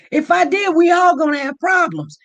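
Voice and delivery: high, loud warning shout; half-joking loud threat. Real, not acted. Warning about flashing drivers.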